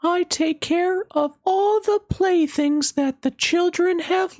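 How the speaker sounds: very high, gentle fairy voice. Acted. Fairy greeting the little rabbit, high and gentle. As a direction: sing it out light and high like a fairy. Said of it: "she said".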